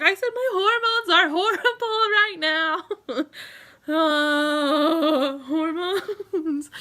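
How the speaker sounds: sobbing laugh about her hormones; crying. Real, not acted. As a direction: break into tears while laughing at yourself. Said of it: "I'm sorry that I cry in front of you".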